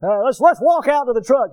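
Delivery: dad with no sympathy; gruff dad. Retold or imitated. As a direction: bark it like an impatient father. Gruff dad voiced in story.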